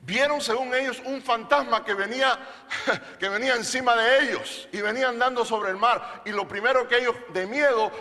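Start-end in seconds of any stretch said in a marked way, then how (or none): none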